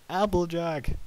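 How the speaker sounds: very loud, high, drawn-out cry; frightened shout. Acted. Worried Twilight calls Applejack a second time, shouting hard. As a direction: yell the name in alarm. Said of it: "Twilight shouted".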